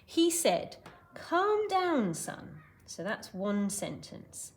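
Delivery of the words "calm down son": lightly voiced father soothing a child; Hogarth's dad. Teacher as Dad to his son, lightly voiced reassurance. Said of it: "what Hogarth's dad said next".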